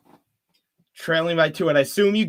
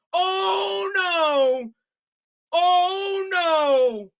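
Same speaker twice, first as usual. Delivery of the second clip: wailing despair; 13 semitones higher, much slower than usual. Real, not acted. Celtics fan caller reacting to the losing shot, wailing despair. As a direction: howl it like your heart just broke. Team loses at buzzer.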